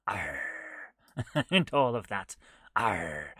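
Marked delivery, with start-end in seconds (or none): 0.1-0.4 lower, clumsy pirate arr; bad pirate voice. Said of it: "I cannot do a pirate voice". 2.8-3.4 lower, clumsy pirate arr; bad pirate voice. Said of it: "I cannot do a pirate voice".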